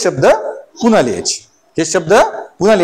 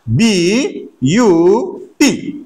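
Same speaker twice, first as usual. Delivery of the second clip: slow spelling; much slower than usual. Shown as a demonstration. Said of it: "मी शब्द लिहिला बघा".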